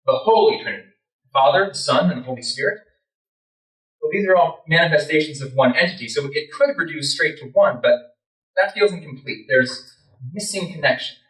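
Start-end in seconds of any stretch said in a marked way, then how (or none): none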